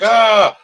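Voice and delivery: high, loud imitated startled "ah"; sudden mock scream of fright; startled yell. Imitating people scared by a snowman prank, startled yell.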